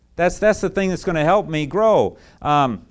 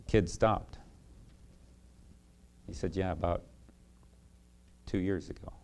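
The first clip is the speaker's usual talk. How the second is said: hushed grave voice; 8 semitones lower, 11 dB quieter, faster than usual. Retold or imitated. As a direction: drop to a hush for the story's punchline. Evangelist's quiet grave climax.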